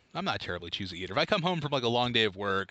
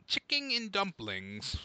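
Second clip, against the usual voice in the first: usual speaking voice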